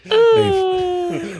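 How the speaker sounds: high mock sob. Mock emotional trailer scene.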